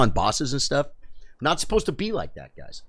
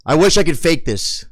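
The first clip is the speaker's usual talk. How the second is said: ordinary delivery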